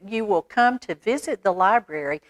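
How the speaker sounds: plain reading voice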